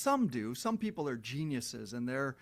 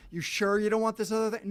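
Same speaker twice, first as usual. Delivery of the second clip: higher, louder, more insistent nagging; a store pestering a buyer, now louder and higher; louder mock upsell; 7 semitones higher, 8 dB louder, faster than usual. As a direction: push the upsell even harder. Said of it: "they ask you over and over".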